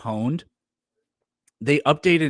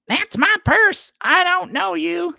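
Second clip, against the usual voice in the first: put-on high, slow whiny boy voice; Bobby Hill impression; 5 dB louder, slower than usual. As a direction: squeak it out like an indignant little boy. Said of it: "That's a Bobby Hill quote".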